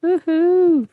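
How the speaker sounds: very loud, high woohoo; excited cheer. Real, not acted. Guest cheers at being called star of the show, whooping loudly. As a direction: whoop it up in celebration.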